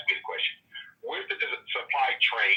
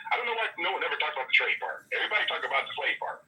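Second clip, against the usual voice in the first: ordinary talk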